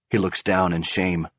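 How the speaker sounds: normal talking voice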